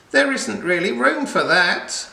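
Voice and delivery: high, loud protest; cried out. Acted. Friendly witch protesting at the dancing princesses, a high, loud cry. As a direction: call out over the crowd in dismay. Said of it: "cried the friendly witch".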